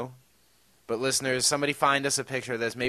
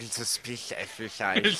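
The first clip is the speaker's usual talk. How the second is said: mock posh British; 4 semitones lower, 7 dB quieter than usual. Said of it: "I'm like".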